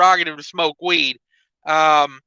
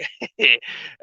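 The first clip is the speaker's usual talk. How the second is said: short real laugh at his own joke; a quick chuckle to himself; amused laugh; 5 semitones lower than usual. Real, not acted.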